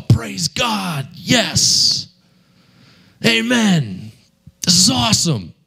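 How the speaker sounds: high, thrilled burst of praise; eager, beaming evangelist; overjoyed. Retold or imitated. Elated reply to God's promise.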